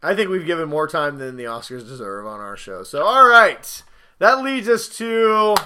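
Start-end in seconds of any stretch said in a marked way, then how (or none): 2.8-5.5 slow, loud host announcement; host segue voice. Acted. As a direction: announce the next segment. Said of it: "show host segue".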